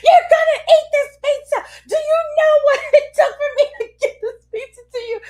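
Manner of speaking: high, loud, fast laughing tirade; laughing mock rant. Mom retelling her pizza rage at daughter, high laughing yell. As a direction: rant it half-laughing, half-crying.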